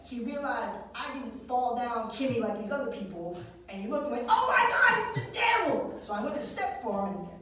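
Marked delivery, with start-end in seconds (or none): 4.3-5.9 very high, loud panicked exclamation; mock-horrified cry. Preacher seeing the devil in boy, mock horror. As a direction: shriek it in hammed-up alarm.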